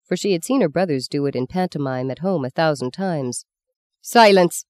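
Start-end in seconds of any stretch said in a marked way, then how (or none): 4.0-4.7 sharp, louder hissed order; nun hisses at the girl, louder; hissed command. A narrator's reading. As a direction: cut her off with a hiss. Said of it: "Sister Agatha hissed".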